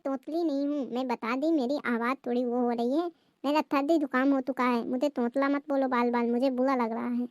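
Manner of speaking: lisped protest, r turned to l; lisping. Acted. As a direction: insist you don't lisp while lisping. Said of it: "मुझे तो लगता है कि आपकी बहू तोंतली है". (तोतली ज़बान में सफ़ाई)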